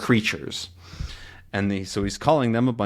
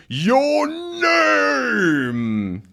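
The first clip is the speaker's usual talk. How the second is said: high, long-drawn shout; very loud yell. Acted. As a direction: bellow it slowly, stretching each word. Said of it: "very loudly".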